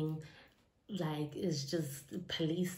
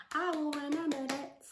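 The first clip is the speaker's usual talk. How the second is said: high-pitched put-on voice; playful grudge vow; 9 semitones higher than usual. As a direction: make a mock vow up high.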